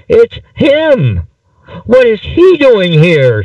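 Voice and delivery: hammed-up stage-play alarm; scream. A narrator's reading. Wife to husband at intruder, theatrical high scream. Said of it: "she screamed at her husband".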